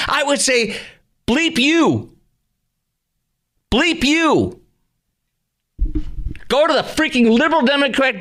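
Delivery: outraged rant. Real, not acted. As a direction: let the outrage boil over at the listeners. Host rants about tax increase.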